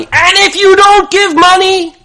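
high, loud mock threat; threatening demand. Imagined rabbi pressing the shul for money, threatening demand. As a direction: raise it to a mock shouted ultimatum.

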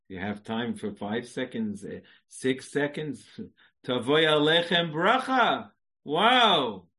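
normal talking voice

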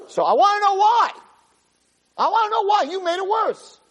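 very high, impassioned retold outcry; insolent demand. Moshe demands that God explain the setback, insolent outcry. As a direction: demand an answer as if betrayed. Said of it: "he shouldn't have talked like that".

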